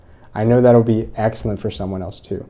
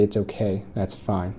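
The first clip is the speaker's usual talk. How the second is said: softer, calming words to yourself; soft self-reassurance; 2 semitones lower, 9 dB quieter than usual. Retold or imitated. Speaker calming their own emotions, soft and gentle. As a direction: quietly soothe yourself.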